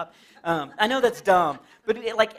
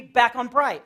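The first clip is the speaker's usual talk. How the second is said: ordinary talk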